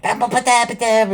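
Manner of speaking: squeaky high minion gibberish; minion babble. Retold or imitated. Squeaky minion chatter as the little guys pile out of the car.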